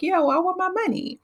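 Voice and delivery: high, sassy comeback; sassy retort. Retold or imitated. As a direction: fire it back with attitude. Retold mother's retort.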